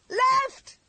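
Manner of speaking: high, loud panicked shriek. A narrator's reading. Frightened driver shrieking at the reckless pilot. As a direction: shriek it in terror. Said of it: "shrieked the driver".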